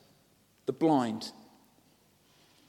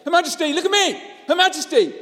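much higher, loud pleading yell; homeless man shouting; 14 semitones higher, 9 dB louder, faster than usual. Retold or imitated. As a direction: yell at the Queen for attention. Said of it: "man starts shouting out".

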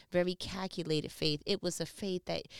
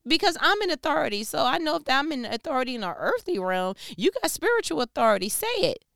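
loud, higher, fast self-assured pitch; confident officer; 4 semitones higher, 8 dB louder than usual. Acted. Roman officer telling Jesus to speak it, loud and sure. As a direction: sell it with bold certainty.

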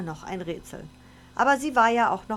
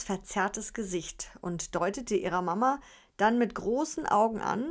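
plain reading voice

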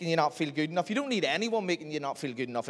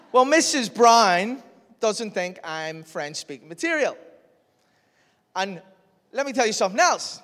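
indignant; 7 semitones higher, 7 dB louder than usual. Retold or imitated. Complaining dramatically to mate.

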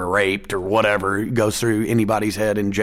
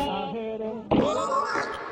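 laugh; 13 semitones higher, 9 dB quieter than usual. Real, not acted. Laughs at own joke.

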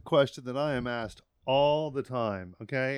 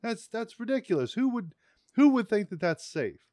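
high, scoffing disbelief; imagined commenter scoffing at writing passwords down on paper; mock incredulous commenter; 5 semitones higher than usual. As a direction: play a scornful online commenter, pitched well up. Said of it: "be like".

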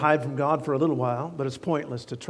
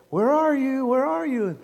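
put-on high, loud singsong to a toddler; cooing hide-and-seek voice for a one-year-old; playful peekaboo call; 9 semitones higher, 5 dB louder than usual. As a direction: call out brightly like playing with a baby. Parent playing hide and seek.

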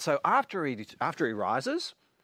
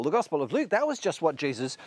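usual speaking voice

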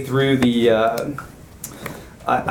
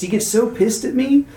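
normal talking voice

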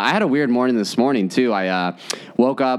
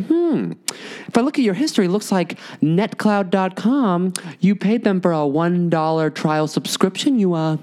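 teasing bank rep; 6 semitones higher than usual. Retold or imitated. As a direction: toy with him over the trial subscription. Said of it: "And he's like".

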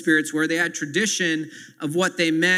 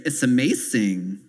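put-on slow, lower, drawn-out child's accent; imitating daughter Ellie; 6 semitones lower than usual. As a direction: mimic how your little girl says it. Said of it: "as Ellie would say".

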